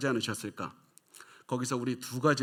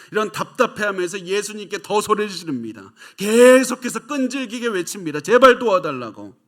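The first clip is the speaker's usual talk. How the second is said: loud and high, climbing to full force; preaching shout; 8 semitones higher, 11 dB louder than usual. Acted. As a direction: hammer it home like the woman's endless cry. Sermon climax on persistent crying. (목청 높여 설교하듯 외치며)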